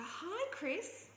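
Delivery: high, chirpy hello; old lady's cheerful high greeting; bright greeting. Retold or imitated. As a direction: greet her sweetly in a lifted voice. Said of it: "she'd go".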